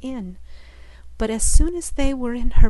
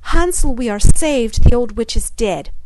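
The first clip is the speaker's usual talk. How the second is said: a much louder, ringing call of relief; joyful call; 10 dB louder than usual. Acted. Gretel calling to Hansel that the witch is dead, joyful. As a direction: shout the good news to him. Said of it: "called out".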